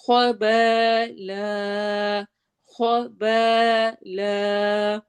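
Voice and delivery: slow خَبَالًا with a stretched final alif; long alif. Shown as a demonstration. Teacher demonstrating madd for students, slowly. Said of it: "الف مدہ کے ساتھ".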